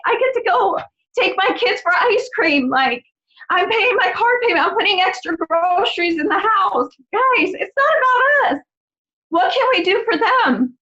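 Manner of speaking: high, tearful and overcome with gratitude; choked up, pushing on through the tears; getting emotional. Real, not acted. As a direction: voice lifting as real tears come. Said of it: "Sorry, I'm getting emotional".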